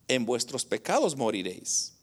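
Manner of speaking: low, softened close of the verse; quoting Christ. Retold or imitated. Preacher ends Christ's warning to sinners, low and soft. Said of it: "Mire lo que dijo Cristo".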